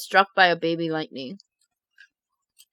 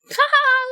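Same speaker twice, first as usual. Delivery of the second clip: mocking laugh. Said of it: "They're, like, laughing".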